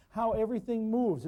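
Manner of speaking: normal talking voice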